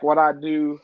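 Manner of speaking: ordinary delivery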